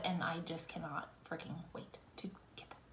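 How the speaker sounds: whispering eagerly; excited whisper. Real, not acted. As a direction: whisper with glee.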